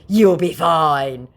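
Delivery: slowed smug scammer impression; mock scammer voice. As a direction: drag out "You'll be fine" like a smug scammer. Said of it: "very wittily responds with".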